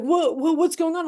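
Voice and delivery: high, loud startled guy; a jumpy stoner caught off guard; retold high surprised whoa. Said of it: "and he's like".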